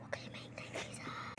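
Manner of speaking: breathy hiding whisper; whispering while hiding. Real, not acted. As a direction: whisper so nobody finds you. Hiding, hears a noise.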